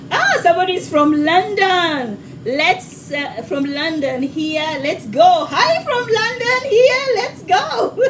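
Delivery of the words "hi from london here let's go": high, drawn-out excited greeting; thrilled high-pitched welcome; excited shout-out. Real, not acted. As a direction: hail the London viewers in a high, stretched voice. Excited shout-out to London viewers.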